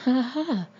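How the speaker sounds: a barking laugh at the cocky pilot; roaring laugh. A narrator's reading. Colonel laughs at captain's cheek, roaring laugh. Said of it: "the colonel roared".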